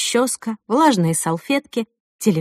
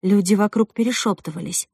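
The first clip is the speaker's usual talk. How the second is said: plain reading voice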